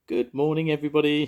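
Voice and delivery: high, loud breezy goodbye; loud yell. A narrator's reading. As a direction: yell it as she hurries off. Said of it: "she yelled".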